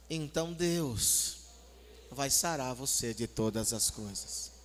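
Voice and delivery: slow, drawn-out soothing reply; unhurried, reassuring scripture quote; calm understanding counsel. Shown as a demonstration. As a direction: answer the rant gently, with long pauses. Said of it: "faz assim e fala ali pra ele".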